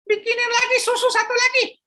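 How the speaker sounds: put-on high childish voice; a little boy at the shop counter; young boy. Philip speaking to shopkeeper Pak Joko, high child voice.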